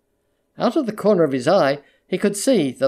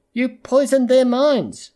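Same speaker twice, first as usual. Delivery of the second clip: slow accusation; 8 semitones higher, 3 dB louder, slower than usual. Acted. Bitter accusation on the train.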